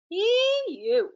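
high squealed ew of disgust. Acted. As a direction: recoil from the stink. Character disgusted by stink.

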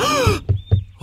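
sharp high gasp of fright; startled gasp. Acted. Boy startled by a voice behind the door, sharp gasp. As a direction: gasp as if caught off guard.

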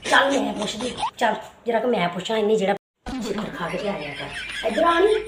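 a brash rural mother picking a fight; scolding village mother. Acted. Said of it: "ਸਾਡੀ ਪੇਂਡੂ ਮਾਂ ਵੀ".

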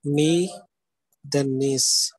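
"Me", then "nis" as separate syllables; teacher dividing "Me" and "nis" with pauses. Shown as a demonstration. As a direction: break the word apart, "Me" and "nis" each on its own. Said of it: "This is an another syllable".

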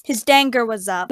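usual speaking voice

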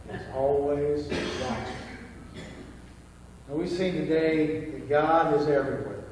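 ordinary delivery

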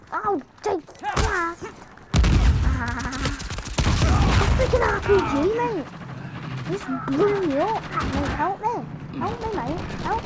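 high, loud cries after being blown up; a gamer screaming at an RPG hit; frantic yelling. Real, not acted. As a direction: shout for help in a panic.

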